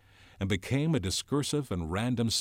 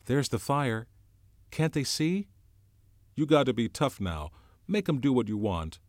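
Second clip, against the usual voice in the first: put-on higher, louder voice of a worried boy; anxious boy Piggy; 6 semitones higher, 5 dB louder than usual. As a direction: fret and urge like a scared schoolboy. Piggy's voice held.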